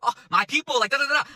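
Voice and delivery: high, bubbly singsong greeting; playful excitement. Retold or imitated. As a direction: gush over meeting fellow Puerto Ricans. Her own excited reply retold.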